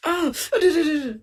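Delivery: high playful ouch; playful pained yelps; mock ouch. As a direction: yelp "aduh" like being pinched. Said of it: "istri yang kita cinta menyubit kita".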